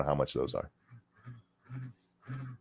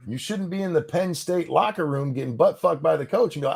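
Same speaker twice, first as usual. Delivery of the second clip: usual speaking voice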